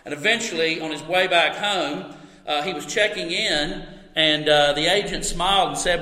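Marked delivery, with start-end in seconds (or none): none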